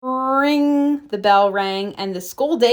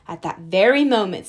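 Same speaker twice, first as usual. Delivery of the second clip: ordinary delivery, nothing marked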